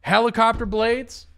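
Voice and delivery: high, incredulous question; real disbelief. Real, not acted. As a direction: raise the pitch in amazed questioning. Repeats the discovery in disbelief.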